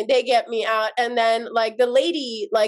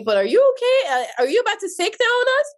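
worried cousin; 9 semitones higher, faster than usual. Retold or imitated. Cousin checks on crying her.

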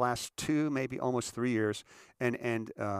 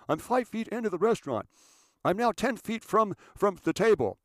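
high, loud self-important phone talk; imitated loud cell-phone show-off; yelling into cell phone; 7 semitones higher, 5 dB louder than usual. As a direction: bark a running commentary into a phone. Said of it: "yelling at cell phones".

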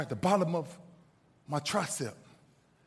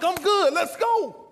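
loud, high-pitched game-time bravado; defiant rallying shout; 11 semitones higher, 7 dB louder, slower than usual. Retold or imitated. Waving off injury to teammates.

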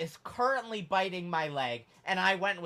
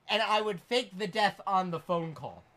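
usual speaking voice

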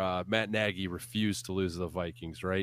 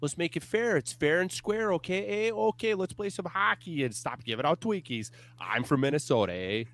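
higher, folksy Minnesotan impression; Minnesotan accent; 8 semitones higher than usual. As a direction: play a polite Minnesota local with a Minnesotan accent. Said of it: "one hell of an impression".

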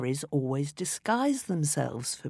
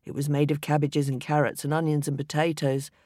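usual speaking voice

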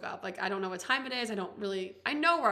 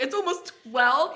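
higher, louder disbelieving question; shocked echo question; 4 semitones higher, 7 dB louder than usual. Retold or imitated. Hungover woman told the time by friends, startled question. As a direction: repeat it back, startled, rising at the end.

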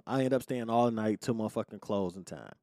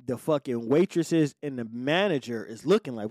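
ordinary talk

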